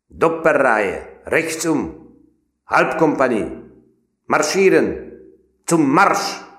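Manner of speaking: high, loud, drawn-out parade orders; shouted German marching orders; German drill commands. A narrator's reading. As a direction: call it across the barracks yard.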